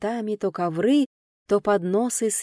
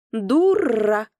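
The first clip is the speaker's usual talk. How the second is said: rude parrot; 5 dB louder than usual. A narrator's reading. Parrot insults the narrator.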